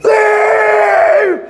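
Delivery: hammed-up shouting pharaoh; frantic shout. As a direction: yell it in a frantic high cry. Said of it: "Paro in his pajamas running around Egypt going".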